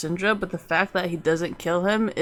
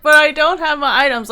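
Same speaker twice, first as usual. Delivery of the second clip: whiny excuse; 7 semitones higher, 6 dB louder than usual. Retold or imitated. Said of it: "It's kinda like".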